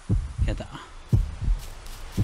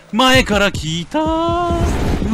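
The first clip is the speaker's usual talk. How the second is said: high, slow stretched cry; alarmed wail; 9 semitones higher, 4 dB louder, much slower than usual. Real, not acted. 前から敵が来て悲鳴まじりに叫ぶ. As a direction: drag out the panic. Enemies appear ahead.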